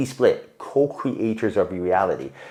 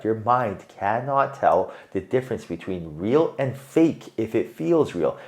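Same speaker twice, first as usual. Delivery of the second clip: slower, each word laid down deliberately; slow repeat; slower than usual. Shown as a demonstration. Reader repeats the point to viewer, slowly and deliberately. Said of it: "I repeat this".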